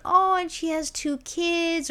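high, slow mock sympathy; mock pity. Pitying the singer's kids, high and slow mock sorrow. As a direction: stretch it out in fake sorrow. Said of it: "I'm like".

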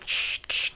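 voiceless walkie-talkie crackle, made by mouth; imitated radio static. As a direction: hiss out mock radio static, softly. Mimics radio after secret signals message.

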